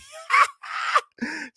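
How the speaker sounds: squealing delighted cackle; high gleeful shriek-laugh. Real, not acted. Cackling at the news.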